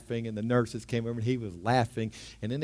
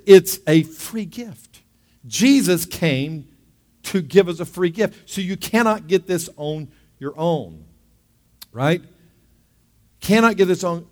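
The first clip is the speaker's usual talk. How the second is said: fervent preaching; 5 semitones higher, 9 dB louder than usual. Acted. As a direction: drive the point home to the congregation. Preaching at full force.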